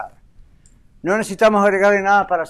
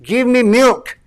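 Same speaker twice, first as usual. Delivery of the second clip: a mock baby's cry for milk, higher and louder; baby demanding milk; 4 semitones higher, 5 dB louder, much slower than usual. Preacher voicing a hungry baby, mock demand. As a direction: play the hungry newborn, pleading loud. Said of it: "Lo único que quiere es".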